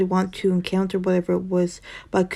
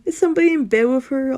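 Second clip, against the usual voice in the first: higher, louder alarmed question; shocked; 7 semitones higher, 4 dB louder, faster than usual. Real, not acted. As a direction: gasp it out, freaked out. Reader reacts to creepy plot.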